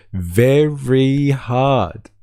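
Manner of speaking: very slow, stretched-out very hard; drawn-out mocking repeat. Shown as a demonstration. Streamer mocking the official's claim, in a very slow drawn-out voice. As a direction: drag each word out to mock the claim.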